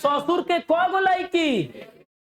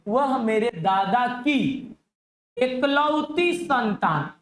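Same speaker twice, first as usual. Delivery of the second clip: very slow read of वह मेरे दादा की एकलौती संतान; much slower than usual. Shown as a demonstration. Teacher reading the puzzle slowly to his class. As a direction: read the question out word by word, very slow. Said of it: "teacher reads question slowly".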